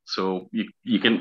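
normal talking voice

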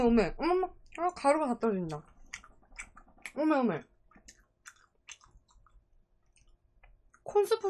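chewing while talking; eating fried chicken; mouth full. Real, not acted. Seen 0: Bites chicken at +0.6, holds it, mouth working between words.

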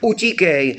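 very high, urgent cry. A narrator's reading. Girl urges her brother to run, crying out. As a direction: send him off with a high-pitched cry. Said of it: "vykřikla Roňa".

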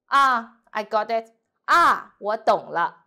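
恍然大悟; understanding ah. Shown as a demonstration. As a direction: finally get it. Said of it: "when you mean understand".

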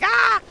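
high, loud imitation of overexcited scream; hyper yell. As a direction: mimic your own hyper yelp. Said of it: "I was, like, too hyper".

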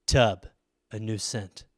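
deep, hushed, dramatic ad announcer; low and soft, like a moody perfume ad; perfume ad voiceover. Retold or imitated. Mocking a perfume commercial, deep hushed dramatic voiceover. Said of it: "it's just like".

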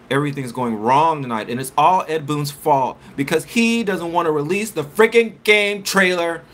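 building exasperated outburst; frustrated rant. Real, not acted. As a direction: vent the frustration, climbing up. Venting about missing game trailer.

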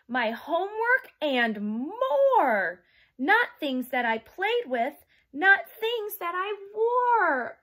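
lively picture-book read-aloud; reading to children. Acted. Teacher reading a storybook to her young class, lively. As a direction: read it like storytime for little kids.

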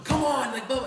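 sports dad shouting from the stands; yelling. Retold or imitated. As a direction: shout it at the umpire.

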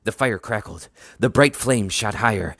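ordinary delivery

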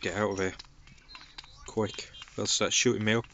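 ordinary talk, nothing marked

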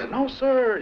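high, loud recruit's no sir; recruit answer. Retold or imitated. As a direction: snap it out at attention. Recruit answers drill instructor.

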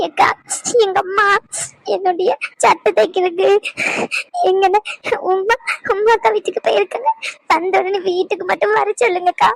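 sobbing plea in broken phrases; tearful, halting request with gasps; crying. Acted. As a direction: get the message out between sobs. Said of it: "அந்த பிள்ளை இப்படி அழுதுகிட்டு போகுது".

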